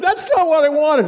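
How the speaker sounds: high, mock upset wife voice; tearful wife. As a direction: squeak it out as the hurt wife, half-hammed. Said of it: "She's sitting there crying".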